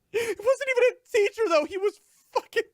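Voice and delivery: very high, loud, crying complaint; mock sobbing rant. Real, not acted. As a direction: wail it half-laughing in outrage. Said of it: "comic crying outrage".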